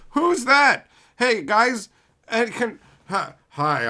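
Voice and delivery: high, loud mock confusion; clueless PR manager. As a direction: call out like a baffled manager seeking help.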